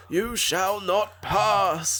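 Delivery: high, slow, drawn-out heroic proclamation; medieval British knight. Acted. Showing the film's accent to her co-host, booming medieval knight. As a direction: declaim it like a grand British knight. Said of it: "some kind of medieval British vibe".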